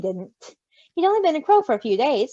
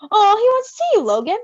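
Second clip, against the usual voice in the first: higher and louder, thrilled; delighted; 9 semitones higher, 7 dB louder than usual. Acted. Roman to Logan, delighted the baby wants him. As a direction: gush over the baby's interest.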